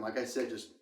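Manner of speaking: ordinary talk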